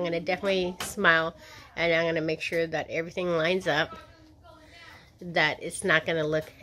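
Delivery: pulling lip aside while talking; finger at lips. Real, not acted. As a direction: hand on mouth showing teeth. Seen 1: Finger touches and pulls lip corner to show teeth while speaking.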